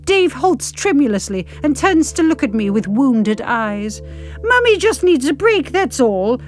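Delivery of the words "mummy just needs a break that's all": explaining. Acted. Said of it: "I explain".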